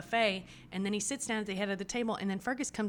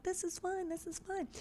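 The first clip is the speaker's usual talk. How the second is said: high, soft, singsong calming; soothing reassurance; 12 semitones higher, 5 dB quieter than usual. Acted. Sister calming Claire down, soothing in a high voice. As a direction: coo it lightly to settle someone. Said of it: "calming her down and being like".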